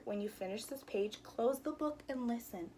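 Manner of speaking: ordinary delivery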